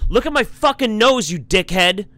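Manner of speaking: high, loud mock outrage; acted-out furious retort; indignant yell. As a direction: yell back at the catcaller. Acting out Lyra's angry retort.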